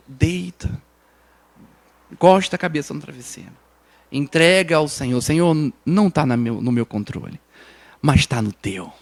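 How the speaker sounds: a preacher softly leading the church in prayer; hushed prayer. Acted. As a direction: pray it quietly to the Lord. Soft prayer to the Lord.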